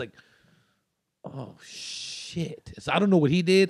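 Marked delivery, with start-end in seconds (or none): 1.2-2.7 hushed oh shit; whispered dismay; whispered realization. Retold or imitated. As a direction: breathe it in dawning horror. Friend realizing they are kids.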